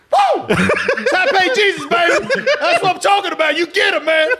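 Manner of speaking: high whooping fan hollers; re-enacted cheering. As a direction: cheer wildly to taunt her. Re-enacting his taunting cheers.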